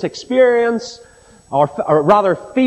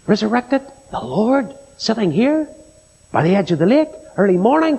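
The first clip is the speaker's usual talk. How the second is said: incredulous questions. Retold or imitated. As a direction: separate wondering questions, each lifting upward. Peter acted as incredulous at news.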